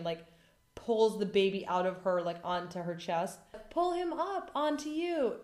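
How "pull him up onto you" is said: high, urgent nurse call; urging. Retold or imitated. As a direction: press her to pull the baby up.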